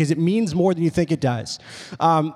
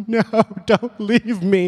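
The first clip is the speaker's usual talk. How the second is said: slow, drawn-out mock-tearful plea; almost in tears; 3 semitones higher, slower than usual. Little girl begging her mom to stay, mock tearful wail. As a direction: imitate a child wailing not to be left. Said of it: "almost, like, brought down to tears".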